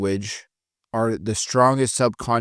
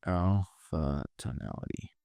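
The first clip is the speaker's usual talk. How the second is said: slow, low and much softer; alpha tonality; 4 semitones lower, 12 dB quieter, slower than usual. Shown as a demonstration. Trainer showing reps the alpha sound, slow and low.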